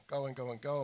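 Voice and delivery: ordinary talk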